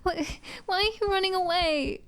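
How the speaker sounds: high-pitched, desperate crying plea; tearful pleading. Acted. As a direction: beg through tears for them to stay. Desperate plea to departing brother.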